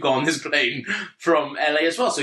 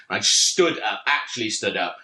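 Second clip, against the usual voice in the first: ordinary talk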